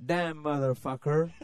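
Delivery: slow, hammed-up Southern American accent. Shown as a demonstration. Englishman showing off his attempt at American, mock Southern accent. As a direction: drag it out in a put-on American drawl. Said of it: "do you wanna hear my American accent".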